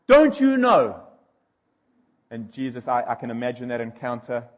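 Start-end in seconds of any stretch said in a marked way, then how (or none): 0.0-1.1 much higher and louder, slow indignant demand; angry Pilate. Retold or imitated. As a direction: bellow it like a rattled governor. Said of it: "And Pilate goes".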